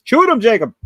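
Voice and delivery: loud, high Cajun swamp holler; swamp people Cajun accent. Retold or imitated. Mocking Louisiana swamp people on TV, loud Cajun accent shout. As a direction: yell it like a bayou hunter in a Cajun accent. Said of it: "talking like fucking swamp people".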